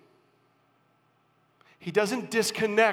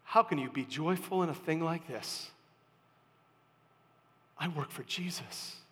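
quiet reply; 3 semitones lower, 11 dB quieter than usual. Retold or imitated. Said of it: "worker would ask".

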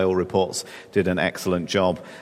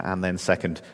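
ordinary talk, nothing marked

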